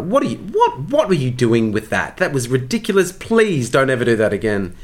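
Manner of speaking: high, exasperated mock scolding; vicious director's note. Blunt director giving actor a note in rehearsal, vicious. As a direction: imitate a blunt, cutting dressing-down. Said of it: "she'd just say the most vicious notes to you".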